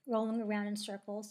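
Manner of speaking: usual speaking voice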